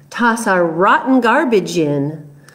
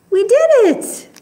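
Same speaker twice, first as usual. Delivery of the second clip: excited; 13 semitones higher than usual. Real, not acted. Teacher cheering with children, excited. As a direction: gasp then much higher cry of joy. Triumph after song.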